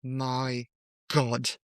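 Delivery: lightly imitated British lady accent. Retelling a tourist at a river in Banff, light British accent. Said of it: "this British lady ... she goes like".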